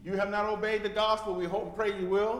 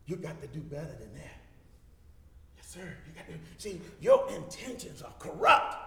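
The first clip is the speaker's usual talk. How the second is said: normal talking voice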